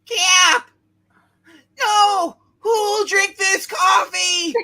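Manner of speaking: loud, slow anguished cry; anguished shout. Acted. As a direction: wail it like a fallen comrade. Hero falls, comic read aloud.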